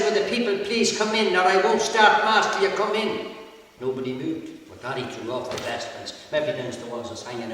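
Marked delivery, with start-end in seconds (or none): 0.0-3.4 high, loud ultimatum; insistent call. Acted. Priest refusing to start mass till they come in, loudly insisting. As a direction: press them firmly in a raised voice.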